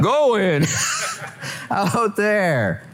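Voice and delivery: high voice breaking into real laughter; laughing through words. Real, not acted. Pastor laughing at his own Jesus impression, laughing high. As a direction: finish the quote while cracking up.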